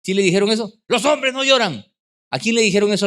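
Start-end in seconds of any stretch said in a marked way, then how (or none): 0.9-1.9 lightly voiced father's admonition, raised pitch; a hint of an old-school dad scolding; stern father. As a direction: imitate a dad laying down the rule. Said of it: "el papá le decía".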